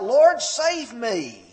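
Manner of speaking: slow, loud desperate plea for help; crying out. Acted. Peter sinking in the waves, crying out to the Lord, slow and loud. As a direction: cry it out like a sinking man. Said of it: "he immediately cries out".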